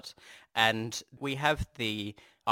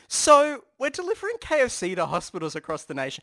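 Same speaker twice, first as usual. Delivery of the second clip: unsure marketing voice; 7 semitones higher than usual. Retold or imitated. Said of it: "the marketing people are unsure".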